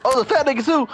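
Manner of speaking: high, loud panicked cry; fleeing in terror; panicked yelp. Real, not acted. Chased by monster in game.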